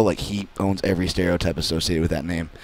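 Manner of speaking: usual speaking voice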